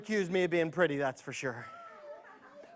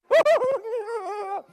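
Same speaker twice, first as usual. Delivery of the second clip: high cackle; 13 semitones higher than usual. Acted. Laugh after chant.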